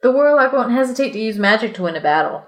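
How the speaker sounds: ordinary talk, nothing marked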